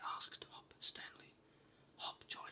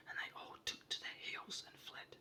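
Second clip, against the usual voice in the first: usual speaking voice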